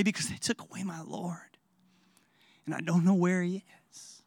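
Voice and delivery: whispered, broken with grief; grieving whisper. Acted. Mary whispering her grief to the angels. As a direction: breathe it out like a mourner who lost everything. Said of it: "Can you hear the pain".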